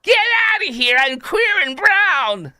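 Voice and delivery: very high, drawn-out pretend yell; hammed-up warning shout; mock battle cry. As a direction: scream it at the enemy in parody. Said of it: "this guy fighting right now in Iran".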